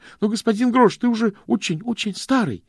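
put-on won't let the old man drop it; insistent. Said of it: "настаивал Стэнли". (Стэнли настойчиво возражает)

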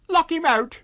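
high, loud, panicked plea; frightened landlady. Acted. Frightened wife pleads.

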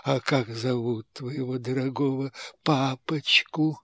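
soft low wheedling; sly hushed question. Acted. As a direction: coax it quietly.

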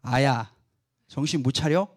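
low, quiet mock telling-off; restrained scolding. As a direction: scold under your breath, voice dropped low. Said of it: "열받으면 뭐라 그래?". (낮게 누른 꾸짖는 말투)

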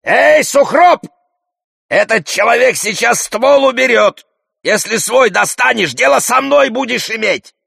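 high, forceful yelled warning; shouting. A narrator's reading. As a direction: shout terms at an armed man a few steps away. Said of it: "останавливается в трёх шагах от хирурга и кричит". (громко кричит с угрозой)

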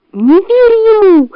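high, drawn-out urgent cry; slow high-pitched shriek of warning; fox crying out. A narrator's reading. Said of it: "закричала настоящая лиса".

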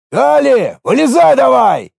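high, forceful guard's command; barked order. A narrator's reading. Local guard to the driver, ordering sharply. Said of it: "приказал местный охранник".

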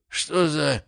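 mumbling through swollen lips; words slurred past a swollen jaw; mumbled through swollen lips. A narrator's reading. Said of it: "промямлил он сквозь опухшие губы".

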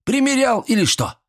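very high squealed question; shrilly squealing old villager. A narrator's reading. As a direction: squeak it out, incredulous. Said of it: "заверещал Митрич".

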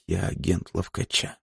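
very soft, drained repetition; lifeless. A narrator's reading. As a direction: say it quietly, with no life left. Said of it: "безжизненно повторила Бланка". (безжизненно, очень тихо)

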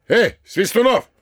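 громко зовёт Свистунова; окликает во весь голос, второй раз выше. Acted. Городничий зовёт квартального. (громкий оклик через дом)

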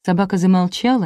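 usual speaking voice

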